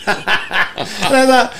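slow words squeezed out through laughter; laughing. Real, not acted. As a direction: still laughing as the words come out. Still laughing from the slogan.

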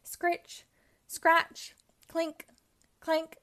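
storybook noises; digging sound effects. A narrator's reading. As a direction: play the sounds of tools in the dirt. Digging noises read aloud.

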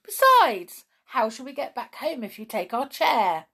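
high, loud start to a protest; indignant. A narrator's reading. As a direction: argue back in indignation. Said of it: "cried Peter indignantly".

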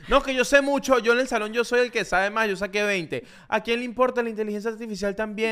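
high, smug schoolkid voice; mock a bragging top student; mocking know-it-all kid. (imitando a un niño sabelotodo presumido)

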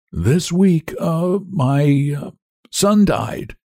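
high, slow, halting confession; strained, faltering high voice; grieving cabman. A narrator's reading. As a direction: hesitate painfully through bad news. Cabman haltingly tells of son's death.

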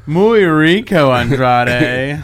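slow, loud mock Spanish purr; hammed-up Spanish flirt. Teasing a friend about Charlotte, loud slow mock Spanish. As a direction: drawl it out like a lovestruck fan.

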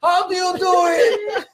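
mock anguished plea to God; neighbor crying out to God. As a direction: beg the heavens like a baffled old man. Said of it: "He cries out to the, to God".

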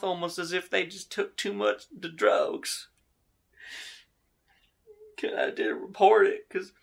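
high, whiny lament over dead dogs; mock grief. Drunk tells investigators about his dead dogs, whining mock grief. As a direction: ham up the grieving drunk.

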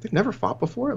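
high, incredulous disbelief; as if you can't believe it; high-pitched disbelief; surprised. Retold or imitated. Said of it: "and I was like".

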